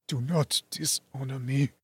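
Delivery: dying man. A narrator's reading. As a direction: barely breathe the words. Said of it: "Another fit of coughing".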